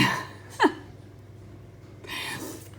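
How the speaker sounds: breathy unvoiced giggle; giggling laugh. Real, not acted. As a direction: giggle at the funny line. Laughing at pattern.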